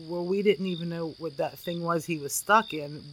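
usual speaking voice

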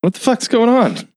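high-pitched imitated disbelief; mock bewilderment. Projectionist answering Pearl's accusation, mock bewilderment. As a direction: jump up high, baffled. Acting his bewildered reaction.

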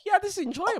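high, satisfied little voice; contented baby voice. Retold or imitated. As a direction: play a pleased baby. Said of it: "they're sitting on a hard rock".

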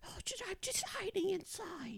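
high, soft hiding mouse; frightened prey animal. Acted. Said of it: "talking mouse".

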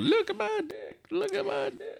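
high, soft mock-boastful voice; tiny high-pitched swaggering taunt; mocking show-off. Cohost mocking a big power thing, high mocking voice.

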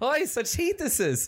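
high put-on German moan; German actor character. Actor sweltering inside latex mask, mock German complaint.

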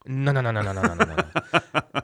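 playful mock Spanish accent; mock Mexican Spanish no. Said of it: "Or as they say in Mexico".

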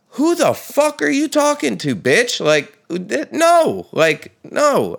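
high, loud indignant retort; heated comeback. Retold or imitated. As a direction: snap back at the guy, offended. Retorting to yelling gym guy.

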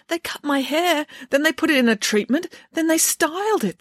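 put-on high girlish voice, aggrieved; high, plaintive child's account. A narrator's reading. Voices Samantha recounting her ordeal.

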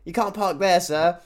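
hammed-up polite British officer; British impression. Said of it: "Love British people".

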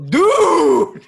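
high, loud alarmed "dude"; high, loud alarmed cry; alarmed shout. Retold or imitated. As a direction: sound the alarm like a worried tattoo artist. Artist urging him to eat.

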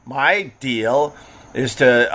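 normal talking voice

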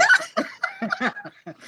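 high burst of laughter; a quick high amused laugh; laughing at the short notice. Real, not acted. Guest laughs about short notice.

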